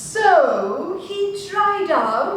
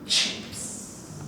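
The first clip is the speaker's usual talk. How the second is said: hungry whisper; 9 dB quieter than usual. Acted. King whispers, licking lips.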